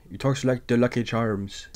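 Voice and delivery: a jokey stage Irish lilt, like a cereal mascot; mock Irish accent. Reviewer mimics Welles' Irish accent, mock Irish lilt. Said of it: "Michael O'Hara talks like this".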